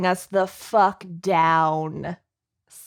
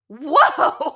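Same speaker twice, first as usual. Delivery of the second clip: excited whoa; 5 semitones higher, 4 dB louder than usual. Retold or imitated. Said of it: "my body is just like".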